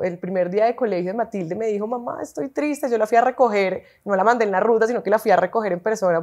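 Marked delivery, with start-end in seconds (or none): 1.8-2.9 put-on soft, slow, higher voice of a little girl; imitated sad child. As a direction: gently mimic a downcast daughter. Mother voices sad daughter. (imitando a una niña triste)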